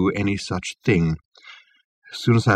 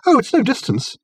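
bright, much higher breezy reply; cheerful; 13 semitones higher than usual. A narrator's reading. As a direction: toss it off cheerfully. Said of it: "said Reg cheerfully".

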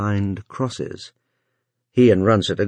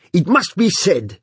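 vibrant declaration; 10 semitones higher, 3 dB louder than usual. A narrator's reading. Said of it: "declared vibrantly".

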